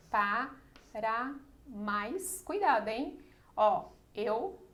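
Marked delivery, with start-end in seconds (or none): 0.1-2.4 "Para mais" very slow, a gap after each syllable; syllable-by-syllable scansion. Shown as a demonstration. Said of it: "vamos fazer o seguinte". ("Para mais" escandido devagar, sílaba por sílaba)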